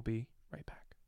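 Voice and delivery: soft whisper. Acted. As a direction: whisper the sign-off. Whispered sign-off.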